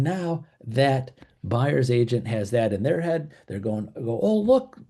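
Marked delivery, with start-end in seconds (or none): 4.2-4.8 high, perked-up realization; acted discovery. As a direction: pipe up as if spotting an opportunity. Buyer's agent voiced spotting an advantage.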